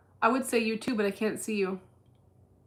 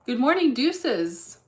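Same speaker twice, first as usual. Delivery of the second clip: slow, sing-song hello, higher and louder; cheery greeting; 5 semitones higher, 7 dB louder, slower than usual. Real, not acted. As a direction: draw out "Good morning" brightly to the chat. Streamer greets chat.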